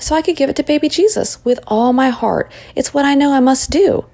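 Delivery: put-on high-pitched, determined child's voice; Rachel, earnest. A narrator's reading. Rachel explains her gift to Mary, earnest and high. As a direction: insist on it high and wholeheartedly.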